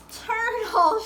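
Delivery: lightly shouted turtle; excited shout. A narrator's reading. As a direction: call out the find. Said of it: "shouted Buddy".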